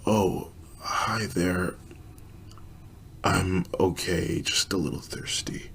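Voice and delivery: very soft, slow, frail voice fading to a whisper; slow, weak voice. Acted. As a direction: barely get the words out, like a dying woman. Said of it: "talk in a very slow and weak voice".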